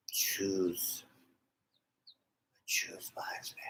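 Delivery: eerie whisper; spooky whisper. Pretend. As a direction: breathe it like a movie oracle. Mock-ominous film quote.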